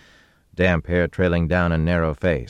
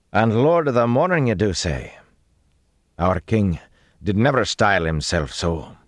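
higher, doubting challenge; pointed disbelief, starting loud; sceptical tone; 6 semitones higher, 3 dB louder than usual. A narrator's reading. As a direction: question the title, unconvinced. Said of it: "darkened at the man's tone".

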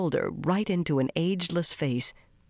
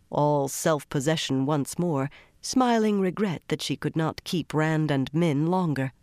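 plain reading voice

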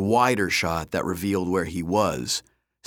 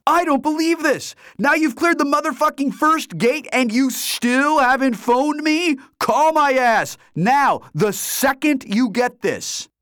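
high, loud, wild rant; excited friend H; 6 dB louder than usual. A narrator's reading. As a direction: rant at your buddy for not calling. H's voicemail rant.

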